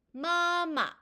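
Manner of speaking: 마마 with a high held first syllable, short drop on the second; high level 마, then brief falling 마; first tone plus neutral tone. Shown as a demonstration. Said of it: "이렇게 소리가 납니다".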